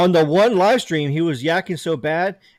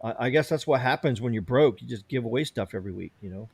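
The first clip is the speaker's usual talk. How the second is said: ordinary delivery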